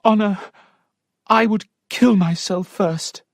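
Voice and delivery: high, earnest Sophia; lightly voiced despair; desperate young lady. Sophia refusing the marriage to her maid, high and earnest.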